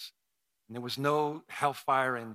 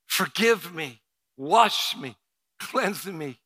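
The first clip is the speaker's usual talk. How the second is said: louder, urgent pleas to God; pleading prayer; 3 semitones higher, 10 dB louder, slower than usual. Acted. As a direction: beg for forgiveness.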